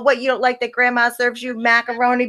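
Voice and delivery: ordinary talk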